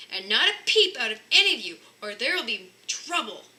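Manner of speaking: loud booming warning. A narrator's reading. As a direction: boom it out like a stern father. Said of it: "boomed Mister Bear".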